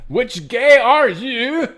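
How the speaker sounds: loud, high, sing-song mock Swedish; exaggerated Swedish lilt at high volume; Swedish accent. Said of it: "like, Swedish or some shit now".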